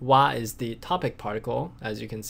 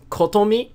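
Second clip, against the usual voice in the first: "Kotomi" lifted high and louder; stress on Kotomi; 7 semitones higher, 4 dB louder than usual. Shown as a demonstration. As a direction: put the weight on "Kotomi". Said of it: "you're emphasizing is".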